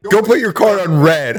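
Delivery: high, imitated teacher's command; scolding teacher. Teacher punishes pupil before the class, mock-stern order.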